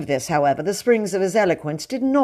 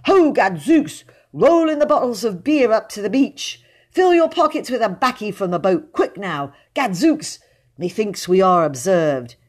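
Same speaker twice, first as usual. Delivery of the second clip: loud, raised pirate orders; swaggering smuggler; 5 semitones higher, 5 dB louder than usual. A narrator's reading. Dick Savage orders his smugglers on the beach, loud and swaggering. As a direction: bark commands to the crew. Smuggler hero commands crew.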